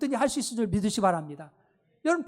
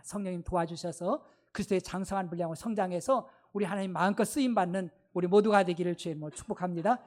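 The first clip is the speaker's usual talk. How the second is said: ordinary talk, nothing marked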